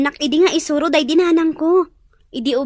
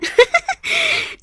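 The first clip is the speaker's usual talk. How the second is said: laugh; 11 semitones higher than usual. Acted. Maid laughs at teasing.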